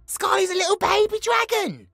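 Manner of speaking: high, loud outburst defending a friend; indignant protest. Acted. Indignant defence of the pet dragon.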